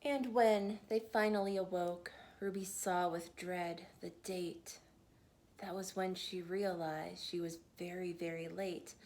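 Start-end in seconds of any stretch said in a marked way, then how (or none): none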